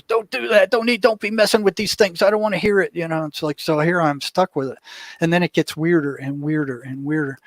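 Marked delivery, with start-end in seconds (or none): 0.0-2.9 freaked-out wife. Retold or imitated. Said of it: "she goes, totally freaked".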